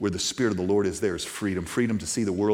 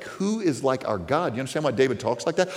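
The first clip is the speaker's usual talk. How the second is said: usual speaking voice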